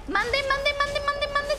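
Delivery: high, rapid-fire repetition; high startled chatter; startled alarm. Real, not acted. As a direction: yelp it over and over in alarm. Startled by a sudden hit.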